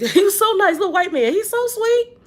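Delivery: high-pitched, laughing coo; amused gushing. Real, not acted. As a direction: gush fondly through a laugh, voice climbing. Gushing over a kind man.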